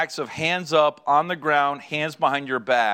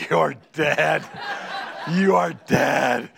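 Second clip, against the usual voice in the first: drawn-out, put-on voice of a furious wife; mock menacing threat; much slower than usual. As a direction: play the wife's threat, slowing to a crawl. Imitates wife's furious threat.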